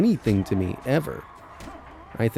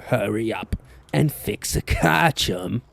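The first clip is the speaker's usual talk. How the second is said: put-on British accent. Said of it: "Max was British like that".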